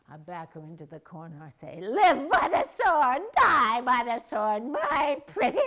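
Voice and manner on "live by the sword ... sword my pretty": loud, high, slow cackling witch threat; Wicked Witch of the West. Acted. Cornering her husband's model like the Wicked Witch, shrieking. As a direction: menace her like a storybook witch. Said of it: "I turn into the Wicked Witch of the West".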